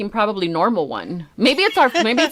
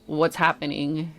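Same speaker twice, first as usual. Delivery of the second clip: soft, calm, unbothered; calm son; 5 semitones lower, 7 dB quieter than usual. Retold or imitated. Son walking in calmly on her tripod meltdown, soft and even. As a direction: ask it gently, no rush. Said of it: "he's all calm".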